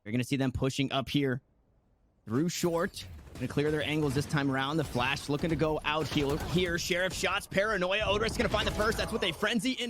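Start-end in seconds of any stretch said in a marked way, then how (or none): 0.1-7.5 quick live play-by-play call; esports caster. Acted. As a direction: call the round as it unfolds.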